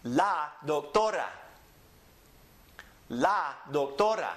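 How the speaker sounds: La doctora as la dok-TO-ra; Spanish pronunciation. Shown as a demonstration. Teacher models for repeating, La doctora stressed on TO. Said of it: "Repeat after me".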